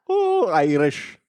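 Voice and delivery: drawn-out, high Irish put-on; mock Irish accent. Co-hosts goofing on the opening, slow mock Irish accent. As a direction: stretch it out in silly Irish. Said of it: "with an Irish accent".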